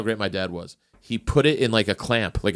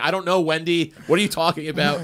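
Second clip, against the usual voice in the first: grandpa's voice; 7 semitones higher than usual. Retold or imitated.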